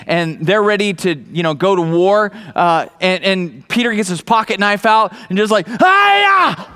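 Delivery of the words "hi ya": high, loud mock battle cry; a hammed-up karate shout; karate yell. A preacher acts Peter swinging his knife, high mock karate yell.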